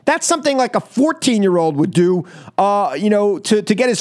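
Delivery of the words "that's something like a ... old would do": ordinary delivery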